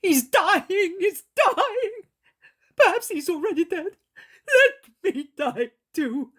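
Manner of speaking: high, loud lament breaking into sobs, slowing at the end; a grandfather despairing over his dying boy; wailing old man. Acted.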